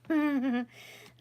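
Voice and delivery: loud burst of laughter; real laugh. Real, not acted. Laughing at own bad accent.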